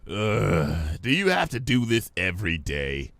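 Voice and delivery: a lightly voiced weary grumble; grumbling vendor. Vendor tired of Pinkie's daily routine, lightly grumbling. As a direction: sigh it out, fed up. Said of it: "the vendor grumbled".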